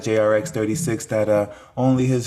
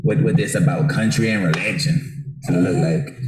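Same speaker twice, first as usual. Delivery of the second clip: usual speaking voice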